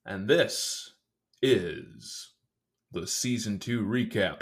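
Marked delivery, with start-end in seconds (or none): none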